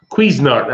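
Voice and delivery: Cuisinart said "KWEE-zin-art"; long "ee" in the first syllable of Cuisinart; unsure pronunciation. Shown as a demonstration. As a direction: Cuisinart as "Kweez-in-art", trying it out. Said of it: "don't know if I'm saying it right".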